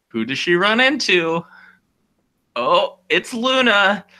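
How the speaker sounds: high, loud, slow reveal; storyteller revealing Luna, hammed up; dramatic reveal. Real, not acted. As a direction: build to the twist.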